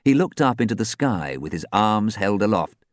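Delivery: plain reading voice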